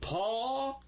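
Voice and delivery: tall held long and high, no L; modeling tall. Shown as a demonstration. Teacher prompts pupils to say it, tall drawn out high. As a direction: draw tall out upward for repeating. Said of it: "I said it louder than you did. Let's say".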